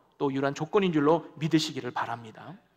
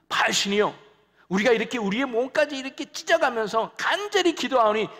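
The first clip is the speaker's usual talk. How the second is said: desperate plea; 6 semitones higher than usual. Retold or imitated. Prophets pleading to Baal.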